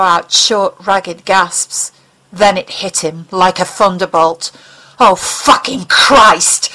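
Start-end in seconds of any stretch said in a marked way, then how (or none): 5.0-6.7 slow, stunned horror; horrified. Acted. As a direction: dread dawning all at once. Sudden horrified realization.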